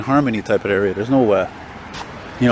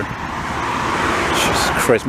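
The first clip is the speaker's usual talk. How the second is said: long whispered Jesus Christ; drawn-out awed whisper; much slower than usual. Real, not acted. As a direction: breathe it out slowly in awe. Awed exclamation at expensive condos.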